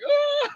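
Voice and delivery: very high, sobbing wail; hammed-up high bawling; mock crying wail. Beaten man slumped against the wall, mock crying wail. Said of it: "I start crying".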